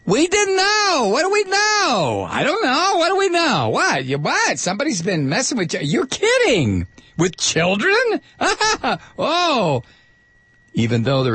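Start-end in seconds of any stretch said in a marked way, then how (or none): 0.1-9.8 hammed-up disbelief, way up in pitch; mock shocked denial. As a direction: protest ignorance in a squeaky, hammed-up panic. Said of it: "the Vatican's defense in this particular case was".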